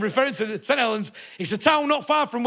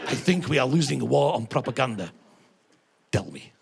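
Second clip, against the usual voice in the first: mock Russian accent; 5 semitones lower, 6 dB quieter than usual. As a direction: ham up a Kremlin aide's Russian accent. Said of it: "met Mister Putin and said".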